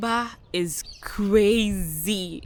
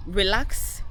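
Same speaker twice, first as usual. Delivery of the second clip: high, loud outraged disbelief; indignant; 5 semitones higher, 6 dB louder than usual. Retold or imitated. Mother in labor told to relax, outraged and loud. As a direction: throw the word back in disbelief. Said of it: "outraged at being told relax".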